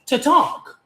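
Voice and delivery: cheerful sassy boast; playful excitement. Real, not acted. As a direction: brag happily about loving to talk. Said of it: "I love yapping my mouth".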